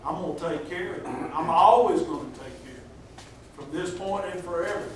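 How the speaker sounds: soft, reassuring fatherly God; tender reassurance. Retold or imitated. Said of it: "God was saying".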